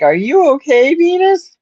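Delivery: high, sweet call to a pet; calling the cat. Retold or imitated. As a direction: coax the hiding kitty gently.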